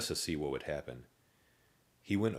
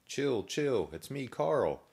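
higher, looping zombie catchphrase; higher singsong zombie voice; repeating zombie; 8 semitones higher, slower than usual. A narrator's reading. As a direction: say it like a broken record.

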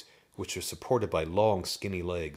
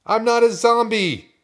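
high, very loud protest; outraged high yell; indignant shout; 14 dB louder than usual. A narrator's reading. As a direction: shout the denial at full voice. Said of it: "Drew Lee shouted".

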